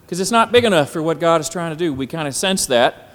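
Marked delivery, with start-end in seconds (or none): 0.1-1.9 mock self-talk. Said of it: "we say".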